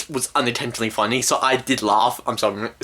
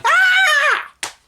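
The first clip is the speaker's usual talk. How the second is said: very high, loud scream of fright; mock scream; 6 dB louder than usual. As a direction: shriek as if suddenly grabbed. Boy grabbed by the ghost.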